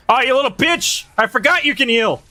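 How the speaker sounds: high-pitched frustrated exclamation; indignant outburst. Real, not acted. Player cursing an enemy that heals, indignant. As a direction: cry out in outrage, pitched well up.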